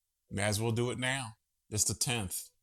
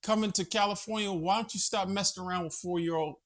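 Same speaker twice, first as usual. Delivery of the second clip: quoting Newsom, pitched higher and fired up; 8 semitones higher than usual. Retold or imitated. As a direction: channel a heated politician's retort. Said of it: "He said".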